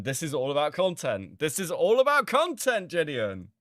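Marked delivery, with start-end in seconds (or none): 1.4-3.5 higher, louder, hammering the point; loud emphatic repeat. Real, not acted. Streamer telling Gideon it is all content, loud emphatic. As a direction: slam the point home to Gideon.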